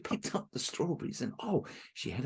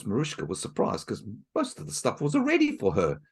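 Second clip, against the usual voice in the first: plain reading voice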